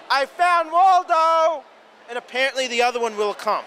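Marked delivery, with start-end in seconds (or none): 0.1-1.6 high, drawn-out triumphant call; mock scream. Shown as a demonstration. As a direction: call it out slowly to summon someone. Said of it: "All you just scream is".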